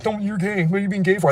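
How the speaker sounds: high, scoffing mock homophobe; a put-on high jeer at a friend. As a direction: sneer it like a jeering bigot.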